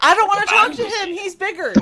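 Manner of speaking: high, loud entitled complaining customer; Karen voice. Retold or imitated. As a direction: whine like a Karen at the counter. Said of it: "like, Karen it".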